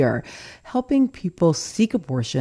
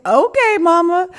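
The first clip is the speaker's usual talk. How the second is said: high laughing "Okay, Mama"; 14 semitones higher, 5 dB louder than usual. Real, not acted. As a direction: laugh it out, amused and fond. Laughs at mother's booklet title.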